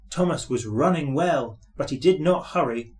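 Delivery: ordinary delivery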